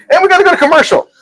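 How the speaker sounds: high, fast put-on announcer voice; a cheeky host voicing the break cue; mock announcer. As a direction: rattle it off like a hurried announcer. Host joking about cutting to break.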